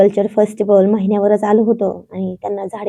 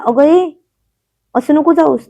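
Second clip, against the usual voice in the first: pleading call; 5 semitones higher, 4 dB louder than usual. A narrator's reading. He begs her not leave.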